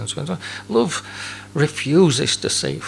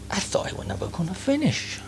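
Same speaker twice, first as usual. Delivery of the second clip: muttered through barely moving lips, higher; ventriloquist mutter; 6 semitones higher, 7 dB quieter than usual. Retold or imitated. Churchgoer complaining over tea about the sermon, muttered. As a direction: grumble it like a ventriloquist. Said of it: "speak like somebody doing ventriloquism".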